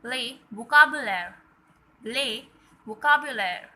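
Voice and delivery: "les vocabulaire" said loudly in French, "vocabulaire" as vo-ka-bu-lair; loud teacher model of "les vocabulaire"; French drill. Shown as a demonstration. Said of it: "Spell with me".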